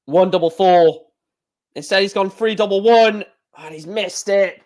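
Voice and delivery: lively play-by-play call of each throw; darts commentary. Acted. Commentator calling the match to viewers, lively play-by-play.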